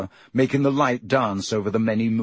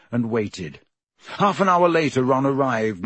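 plain reading voice

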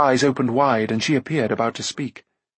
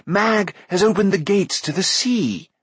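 high, urgent warning cry; narrator's contained shout, pitched far up; shouted alarm; 7 semitones higher than usual. A narrator's reading. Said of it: "he shouted".

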